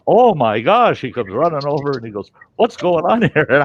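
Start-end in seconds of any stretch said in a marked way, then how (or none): 0.1-1.0 loud, high shock; alarmed exclamation. Retold or imitated. Surgeon walking in on the struggle, alarmed exclamation. 2.6-3.5 loud, high, startled question; alarmed demand. Retold or imitated. Surgeon running over to the bed, alarmed demand.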